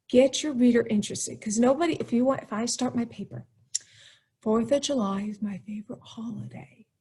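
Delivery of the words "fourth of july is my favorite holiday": listless, hushed boring sentence; boring opening. Shown as a demonstration. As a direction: read it softly with no interest at all. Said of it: "that's kind of boring".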